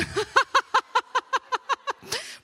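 high, loud helpless giggle; real burst of laughter. Real, not acted. Amused by an interruption on screen.

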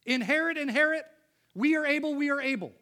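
loud, high-pitched rallying cry, each phrase doubled; emphatic doubling. Retold or imitated. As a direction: call it out forcefully, hammering every repeat. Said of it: "he doubles up".

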